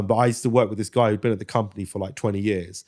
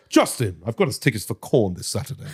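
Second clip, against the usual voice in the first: Shakespearean tone. Retold or imitated. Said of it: "he would speak like a Shakespearean character".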